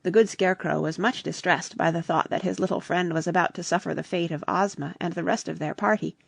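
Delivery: ordinary delivery, nothing marked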